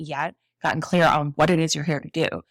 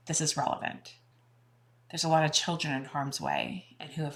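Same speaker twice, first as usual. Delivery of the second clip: soft, restrained and sorrowful; grave, heartfelt; 8 dB quieter than usual. Real, not acted. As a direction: speak quietly, pausing under the weight of it. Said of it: "I have a lot of feelings as a mother".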